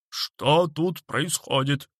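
slow, stern official demand; policeman's bark. A narrator's reading. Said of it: "рявкнул служитель закона". (строго рявкнуть)

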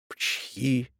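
soft, choked sneeze. A narrator's reading. Hill chokes on his own dust, sneezing. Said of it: "чихнул он опять".

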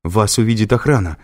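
threatening. A narrator's reading. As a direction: bluff a threat to the attacker. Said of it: "пригрозила она". (угрожающе)

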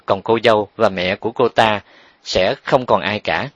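plain reading voice

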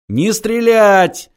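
shouted order; shouting. A narrator's reading. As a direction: yell it slowly. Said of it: "заорал Фидель".

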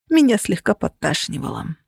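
usual speaking voice

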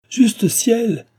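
high, loud indignant exclamation; astonished, indignant. A narrator's reading. Woman answers his accusation, astonished and indignant. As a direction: cry out in shocked indignation, pitch soaring. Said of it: "étonnée et indignée à son tour".

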